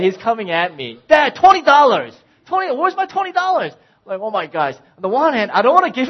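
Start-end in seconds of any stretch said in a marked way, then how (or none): 1.1-3.7 higher, louder insistent son; demanding kid. Retold or imitated. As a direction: hound dad for the cash. Son demanding money daily.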